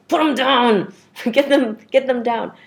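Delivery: high, loud, urgent mock orders; acted self-command. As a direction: play-act forcing feelings back under. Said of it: "I'm pushing those tears down".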